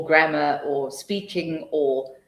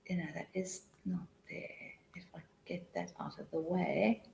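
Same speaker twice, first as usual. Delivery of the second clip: whispered aside; 17 dB quieter than usual. Real, not acted. Moving slide content.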